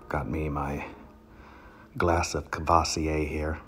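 normal talking voice

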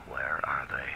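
breathless whispered worry. Acted. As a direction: whisper it as if afraid to hope. Noir detective's anxious inner thought.

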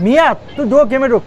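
shouted command; loud urging. Real, not acted. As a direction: yell at him to get in. Urging teammate into game.

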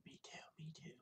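unvoiced whisper. Real, not acted. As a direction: breathe it out softly. Quiet reply on camera.

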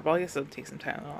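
usual speaking voice

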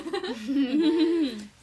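loud burst of giggling; loud laugh. Real, not acted. Streamer amused by a self-appointed tourism ambassador, loud laugh.

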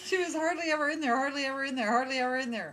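rattled off fast and loud, laughing through it; fast tongue-twister. Shown as a demonstration. Laughing as the friend dares her to say it fast. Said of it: "Say that three times as fast as you can".

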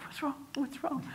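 high, soft anxious question; retold alarm. [name] asks the crying mother what's wrong, mock alarm. Relative reacts to her phone news.

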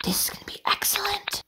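hushed, soft aside; excited whisper. Acted. Director aside during filming, excited whisper. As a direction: whisper it so the recording carries on.

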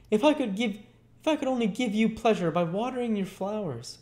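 put-on very high, fervent boy's voice; gushing, high-pitched child; eager cry as Oliver. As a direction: plead earnestly as a grateful little boy. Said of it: "cried Oliver".

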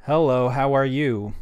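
loud, slow, careful phrase for the translator; clear dictation into phone. Shown as a demonstration. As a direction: speak slowly and clearly into the app. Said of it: "maybe it's this one".

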